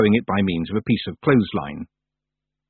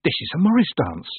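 ordinary delivery

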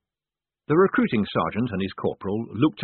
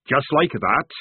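hearty sergeant; 5 semitones higher, 4 dB louder than usual. Acted. Sergeant speaks.

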